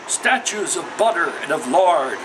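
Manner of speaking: ordinary delivery, nothing marked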